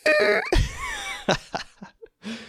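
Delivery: squeaky high-pitched laugh; a laugh that jumps way up; laughing mid-explanation. Real, not acted. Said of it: "I'm doing a super high-pitched".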